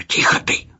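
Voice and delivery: urgent whispered hush; hissed whisper. A narrator's reading. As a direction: hiss it in a whisper. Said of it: "зашипел маэстро".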